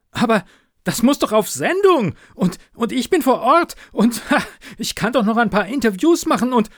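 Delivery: loud, high, fast rush with a nervous laugh; frantic pleading. A narrator's reading. Reporter pleading with her editor, frantic and high. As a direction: tumble over the words.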